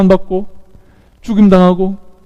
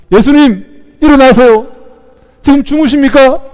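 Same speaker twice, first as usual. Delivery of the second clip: high, loud cry for help; acted panicked appeal; urgent plea; 10 semitones higher, 7 dB louder than usual. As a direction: wake him urgently as the waves close in. Disciples wake Jesus amid storm.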